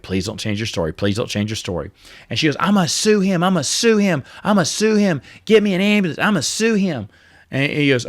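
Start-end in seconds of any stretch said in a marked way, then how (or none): 0.0-1.9 low, much softer pleading murmur; hushed nervous prayer under breath; anxious plea. Retold or imitated. 2.6-7.1 higher mock voice of an indignant driver; mocking the lady. Mimicking the angry lady driver.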